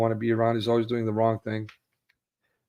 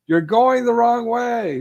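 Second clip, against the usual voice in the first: high, slow shouted warning; screaming warning; 12 semitones higher, 6 dB louder, slower than usual. Retold or imitated. Stranger yelling across the freeway barrier, high slow shout. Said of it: "they're screaming".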